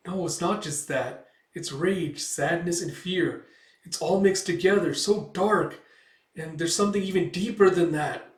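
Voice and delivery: lightly acted elf in distress; troubled dismay. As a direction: build from worry to dread. Elf overwhelmed by dark feelings.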